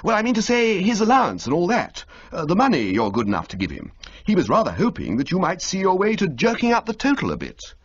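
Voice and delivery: fumbling to ask for cash; awkward young toff. Acted. Bertie to Old Little about the allowance, awkward high toff voice.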